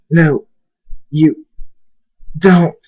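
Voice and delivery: strained, determined refusal; defiant. Acted. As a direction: push each word out against the attacker. Defies the attacking assassin.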